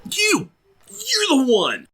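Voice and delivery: high-pitched, pointed "you're the one"; dramatic accusation. Pretend. Mock accusing the magazine subscriber.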